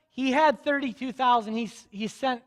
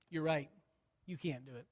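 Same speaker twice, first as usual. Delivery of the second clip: hushed; 7 semitones lower, 14 dB quieter than usual. Real, not acted. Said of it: "preacher agreeing softly".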